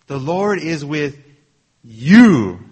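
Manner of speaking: pause, then a booming "you"; stress on YOU. Acted. Angel's word to Gideon in a sermon, explosive stress on "you". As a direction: hold back, then punch "you" very loud. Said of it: "he was emphasizing this word, "you".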